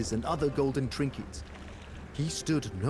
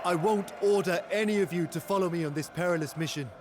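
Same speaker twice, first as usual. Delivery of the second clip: builds from raised voice to full shout; rallying cry; 7 semitones higher than usual. Acted. Hero rallying warriors to rescue their people, rising battle shout. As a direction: rouse the men to join you, rising to a shout.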